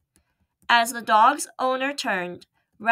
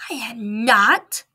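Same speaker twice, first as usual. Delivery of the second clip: indignant; 2 semitones higher, 5 dB louder, slower than usual. Acted. Mouse protests indignantly.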